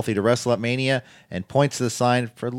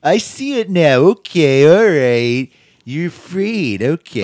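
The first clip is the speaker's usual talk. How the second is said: loud, higher mock realization; hammed-up wrestling promo; 6 semitones higher, 9 dB louder, slower than usual. Triple H tells Becky she is afraid, hammed-up and loud. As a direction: imitate a wrestler announcing he has seen through someone.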